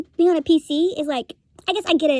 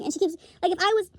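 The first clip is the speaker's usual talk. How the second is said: normal talking voice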